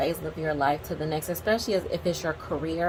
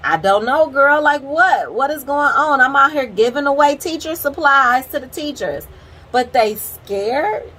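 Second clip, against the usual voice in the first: loud, higher-pitched exasperated protest; retold indignant bafflement; 8 semitones higher, 10 dB louder than usual. Vlogger retelling her answer to a coworker, indignantly baffled. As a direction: protest in loud disbelief, stretching the final question.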